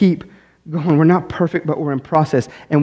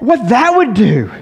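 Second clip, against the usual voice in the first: raised, pleading preacher's voice building to a peak; full-force preaching; 9 semitones higher, 3 dB louder than usual. Acted. Pastor pressing the church about sin, preaching at full force. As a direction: drive it home to the congregation.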